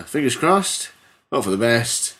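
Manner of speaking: usual speaking voice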